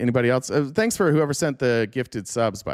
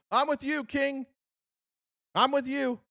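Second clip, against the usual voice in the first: much higher, fawning mock voice; mock fawning loyalist; 9 semitones higher, 4 dB quieter than usual. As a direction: simper your loyalty to the king.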